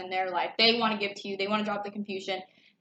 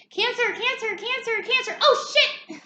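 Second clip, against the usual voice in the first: much higher, calling out then a louder yelp; tripping card voice; 12 semitones higher, 3 dB louder than usual. Pretend. Reader voicing a tarot page that trips and falls, much higher calling. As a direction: play a clumsy page card falling over.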